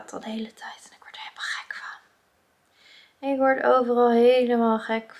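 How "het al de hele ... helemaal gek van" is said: frustrated whisper; drops to a tired whisper. Real, not acted.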